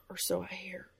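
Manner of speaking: whispered trail-off. Acted. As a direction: let it fade into a whisper. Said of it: "He trailed off".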